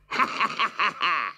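high gloating cackle; witch cackle. Acted. Witch gloats over the children.

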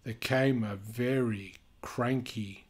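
lively storybook reading voice; animated read-aloud for kids; reading to children. A narrator's reading. Reading picture book aloud.

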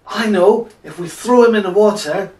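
high, loud, eager burst; bright-idea exclamation. Acted. Sailors propose throwing Jonah overboard, eager exclamation. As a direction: blurt out the sudden idea.

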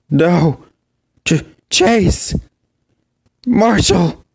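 high, stammering cry of horror; young pup crying out in horror at his friends' deaths; anguished cry. Acted. Pup sees friends killed.

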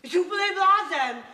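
high, loud, indignant scolding; exasperated reproach. Acted. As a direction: tell the husband off sharply. Manželka vyčítá muži jeho výbuch. (rozhořčená hlasitá výčitka)

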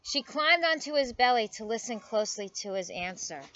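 animated storybook reading with lively pitch swings; story read to children. A narrator's reading. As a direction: read it aloud for little listeners. Reads picture book aloud to children.

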